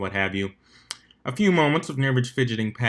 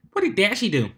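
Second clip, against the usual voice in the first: worried Pinkie; 9 semitones higher than usual. Acted. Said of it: "Pinkie asked, worried".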